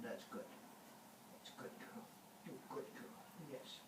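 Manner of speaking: soft whisper to the dog; whispered praise. Real, not acted.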